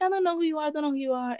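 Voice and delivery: hammed-up playing dumb; acting dumb. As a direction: pretend you've never heard of him. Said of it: "the guy was acting dumb".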